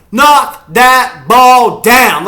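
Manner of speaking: each word yelled slowly, high and loud; drawn-out shout. Real, not acted. Fan savoring the defense's knockdown, slow drawn-out shout. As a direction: bellow it word by word.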